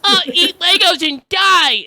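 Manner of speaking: high, loud, drawn-out mock kid taunt; imitated little kid. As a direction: yell it like a bratty kid gamer. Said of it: "You have little kids going in there".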